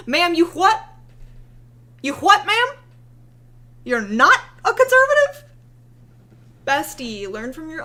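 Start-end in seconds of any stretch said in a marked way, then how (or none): 0.1-5.4 much higher and louder disbelief; incredulous mock yell. Imitating followers shouting disbelief at her, incredulous mock yell. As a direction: climb into shocked shouting on "not a conservative". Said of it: "her conservative followers said".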